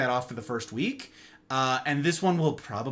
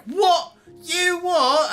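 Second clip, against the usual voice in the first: mock British you what, higher and louder; sudden British; 14 semitones higher, 5 dB louder, much slower than usual. Host to chat, sudden playful British accent. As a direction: slip into a jokey British accent. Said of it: "why it's British all of a sudden".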